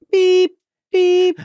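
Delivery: high, loud imitated electronic beep; beeeep, held high and loud. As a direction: make a long piercing beep sound effect. Imitates merging sound effect.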